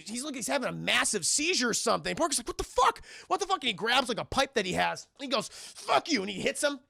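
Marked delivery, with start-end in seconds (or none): 2.5-3.6 high-pitched disbelief; mock alarm. Acting Parker watching Ash convulse, mock alarm. 5.5-6.2 high-pitched angry outburst; mock angry cry. Acting Parker swinging a pipe, mock anger. Retold Parker hitting Ash.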